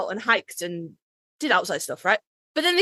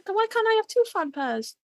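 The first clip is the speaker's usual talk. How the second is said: mock whine; 11 semitones higher than usual. Real, not acted. Playful protest at podcast partner.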